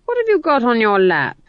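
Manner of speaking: put-on Granny interrogating a nervous girl; stern old witch. Granny demanding what Magrat holds, stern.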